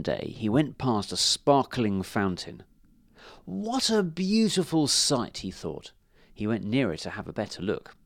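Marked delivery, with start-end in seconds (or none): 3.5-5.4 high, drawn-out wonder; slow, admiring little ant voice; marvelling ant. A narrator's reading. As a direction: gaze in wonder, pitched up.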